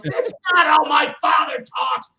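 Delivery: fuming at the movie's fake accent; indignant rant. Son complaining about movie accents, loud and indignant. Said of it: "I'm like".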